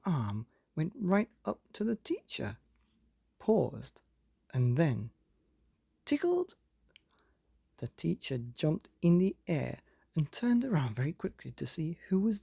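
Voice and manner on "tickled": squeaky high on tickled; playful high squeak. A narrator's reading. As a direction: pop it up high and bright. The climactic tickle.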